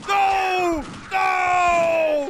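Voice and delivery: high, loud pleading cries; panicked shouting. Real, not acted. Streamer yelling to stop the fire on a friendly guard, panicked shouting. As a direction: shout in alarm, stretching out each no.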